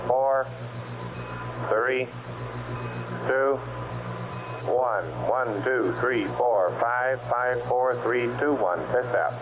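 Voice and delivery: crackly radio transmission; astronaut radio. Real, not acted. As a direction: talk as if over a radio link. Radio count test over net.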